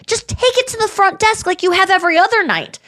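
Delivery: high-pitched, pleading exasperation; exasperated. Retold or imitated. Ranting at the courier who would not leave food, exasperated. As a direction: hold the pitch up in fed-up protest.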